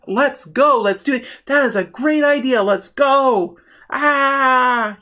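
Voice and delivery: eager Knight of Wands, raring to go; rally everyone with rising excitement into a drawn-out cheer; gung-ho excitement. Acted. Reader voices the impulsive Knight of Wands, gung-ho excitement. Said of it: "the Knight of Wands is just like".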